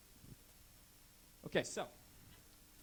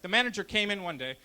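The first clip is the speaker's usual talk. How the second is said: normal talking voice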